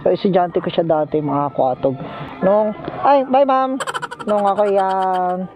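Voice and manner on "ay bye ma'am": ordinary talk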